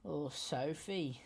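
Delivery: put-on slow, drawn-out Australian accent. Cohost asked for Australian, so he obliges in an Australian accent. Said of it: "Can you do Australian".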